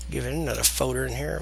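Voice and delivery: usual speaking voice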